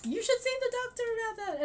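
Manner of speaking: high, fast, fussing mum voice; freaked-out mum. Retold or imitated. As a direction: mimic a panicked mother. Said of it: "she kinda freaked out".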